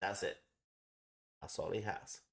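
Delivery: normal talking voice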